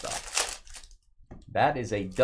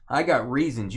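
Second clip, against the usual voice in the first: usual speaking voice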